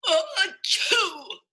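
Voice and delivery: high acted sneeze. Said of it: "awoke with a sneeze".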